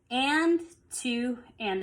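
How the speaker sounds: slow count with "and" stretched and stressed; emphasis on AND. Shown as a demonstration. Teacher showing the offbeat, slow count stressing "and". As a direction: lean on the first "and", counting slowly. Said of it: "The emphasis is on that and".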